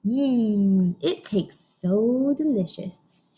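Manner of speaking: slow, drawn-out mmm of delight; savoring. Acted. Teacher pretending to sip the moo shake, slow and savoring. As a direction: taste it as you say it.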